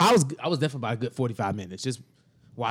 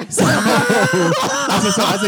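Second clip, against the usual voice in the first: laughing; 5 semitones higher, 5 dB louder, much slower than usual. Real, not acted. Laughing while retelling call.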